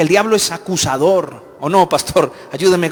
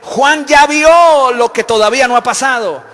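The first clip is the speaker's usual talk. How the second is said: fervent preaching; 8 semitones higher, 7 dB louder than usual. Acted. Preacher rousing the church about God's foreknowledge, loud and fervent. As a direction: preach it out to the congregation with fire. Preaching builds to full force.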